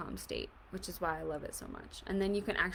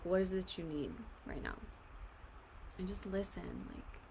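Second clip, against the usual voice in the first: hushed, gentle guiding voice, fading softer; soft guided meditation; 7 dB quieter than usual. Acted. Coach guiding viewers through tapping, hushed and soothing. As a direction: ease the listener into stillness.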